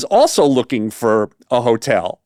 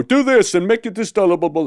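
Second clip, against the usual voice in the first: lightly mocked bossy promoter, higher; 6 semitones higher than usual. Promoter barking orders at the band, lightly mocked. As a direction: toss off orders like a pampered boss. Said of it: "throwing orders at people".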